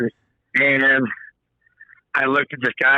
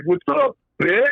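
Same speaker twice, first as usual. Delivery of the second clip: put-on drunk biker taunt; 7 semitones higher than usual. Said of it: "was like".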